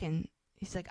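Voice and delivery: normal talking voice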